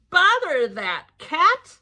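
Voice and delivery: slow, exasperated grumble; drawn-out annoyed complaint; grumbling parents. Acted. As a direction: drag it out like tired parents.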